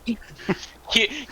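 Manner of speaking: ordinary talk, nothing marked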